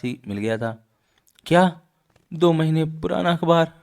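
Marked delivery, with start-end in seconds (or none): 1.5-3.7 high, incredulous outburst; astonished question. Acted. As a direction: react in shocked disbelief, pitched up. Reacting to a trick in comic.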